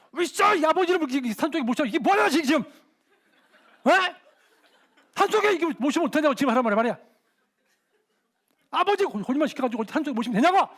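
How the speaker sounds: loud, high shouting rebuke, fast in bursts; furious scolding. Retold or imitated. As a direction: blast the old man with a righteous telling-off. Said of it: "막, 막, 막 쳤어요". (높고 큰 소리로 호통치기)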